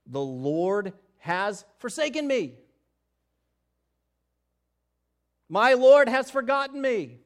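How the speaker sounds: rising, loud anguished cry; forsaken cry. Acted. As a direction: cry out as one abandoned. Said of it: "But Zion said".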